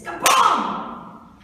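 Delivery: loud, drawn-out imitated crash; sudden bang. Mouse hears the trap go off, a loud booming bang. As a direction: boom it out like a trap snapping shut.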